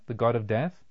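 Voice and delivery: usual speaking voice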